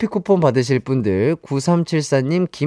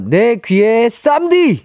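playful rapper voice; 6 semitones higher, slower than usual. Pretend. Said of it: "요렇게 하니까 또 재밌네요".

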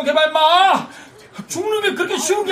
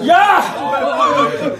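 sudden sharp yell from the bridge; 11 semitones higher than usual. Acted. Man on the bridge yelling at the student. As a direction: bark a warning across the water. Jumper yells at rescuers from bridge.

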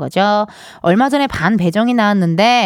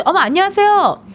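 delighted surprise; 9 semitones higher than usual. Real, not acted. Greeting surprise guest.

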